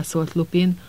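usual speaking voice

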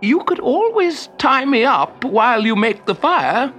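high, strained, coaxing wizard; helpful suggestion. A narrator's reading. Captive wizard tricks troll, high and helpful. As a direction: offer it up with innocent helpfulness. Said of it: "the wizard suggested helpfully".

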